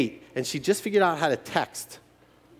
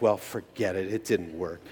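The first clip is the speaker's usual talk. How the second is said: giving up; 9 semitones lower, 6 dB quieter than usual. Retold or imitated. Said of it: "picture her being like".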